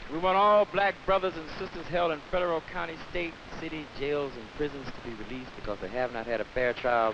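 ordinary talk

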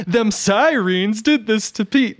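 mock scream, high and drawn out; imitation of a hollering movie character, slow and high; retold screaming. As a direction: drag the words out in a high, hammed-up holler.

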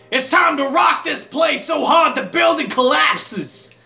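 high, loud party yell; over-the-top rock-out shout; rowdy shout. Acted. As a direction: belt it like a wild party-starter. Reading rowdy character line.

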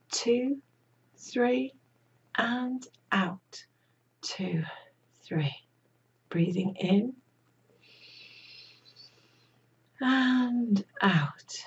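slow breath count with long pauses; guided breathing. Real, not acted. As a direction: lead the breaths, drawing out "and", whispery on the exhale. Leads breathing exercise.

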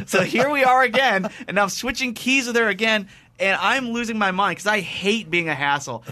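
high-pitched, worked-up comic exasperation; exasperated rant. Retold or imitated. Guest switching keys yet another time, exasperated. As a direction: rant as if at your wits' end.

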